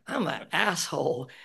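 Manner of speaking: ordinary delivery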